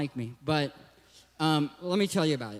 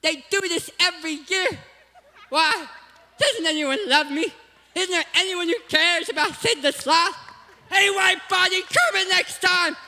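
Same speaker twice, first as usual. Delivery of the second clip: much higher lisping cartoon sloth voice, whiny and lonely; high pitiful cartoon sloth pleading to be loved; Sid the Sloth; 10 semitones higher, 3 dB louder than usual. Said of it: "I used to do Sid the Sloth all the time".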